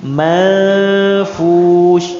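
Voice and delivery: "Manfush" dengan nun disamarkan, tarik panjang; samarkan nun. Shown as a demonstration. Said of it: "nunnya disamarkan ya". (nun dibaca samar)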